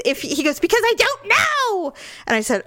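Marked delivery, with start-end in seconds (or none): 0.6-1.9 high-pitched mock outburst; exasperated husband. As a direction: imitate a husband at the end of his rope. Retold quarrel, husband exploding.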